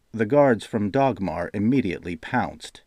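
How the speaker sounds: ordinary delivery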